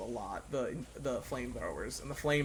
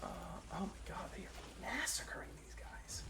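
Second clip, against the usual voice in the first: whispering; 4 semitones higher, 11 dB quieter than usual. Real, not acted. Watching a massacre.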